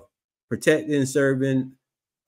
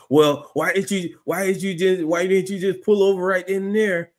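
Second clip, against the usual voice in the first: mocking cop voice; 6 semitones higher than usual. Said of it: "And they come up".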